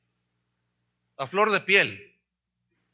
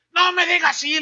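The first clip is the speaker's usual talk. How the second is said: higher, louder sharp rebuke; scolding mother; 9 semitones higher, 5 dB louder than usual. Retold or imitated. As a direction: warn the child firmly. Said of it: "le dice". (madre regañando, más aguda y fuerte)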